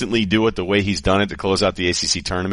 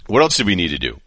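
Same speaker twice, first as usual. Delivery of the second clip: ordinary talk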